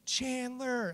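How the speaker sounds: high, drawn-out call, softened; mock scream. Owner driving and calling his lost dog, mock scream. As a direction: imitate calling from a moving truck. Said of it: "I'm just screaming".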